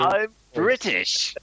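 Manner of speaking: hammed-up British impression, high and very drawn out; milk it slowly in a high theatrical voice; George Mason. Shown as a demonstration. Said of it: "an Eddie Izzard doing George Mason voice".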